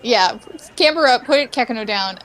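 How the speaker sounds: ordinary talk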